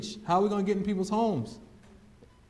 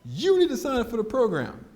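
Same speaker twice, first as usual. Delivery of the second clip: frustrated; 7 semitones higher, 5 dB louder than usual. Retold or imitated. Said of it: "so the guy got frustrated".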